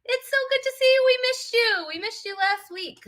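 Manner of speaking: high, fast, gushing hello; gushing welcome. Real, not acted. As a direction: squeal a delighted welcome. Welcoming back a missed guest.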